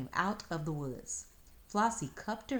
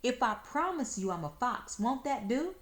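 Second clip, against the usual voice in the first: pleading whine; 7 semitones higher, 3 dB louder than usual. Acted. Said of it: "crying like a natural-born baby".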